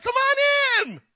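bright calling-out invitation; welcoming shout. Retold or imitated. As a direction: call them in from the storm. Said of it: "And he's like".

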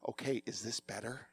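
a mock-quiet reply after being told to tone it down; toned way down. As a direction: drop the volume right down to test her. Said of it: "Then tone it down".

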